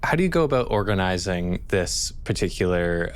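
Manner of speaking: ordinary delivery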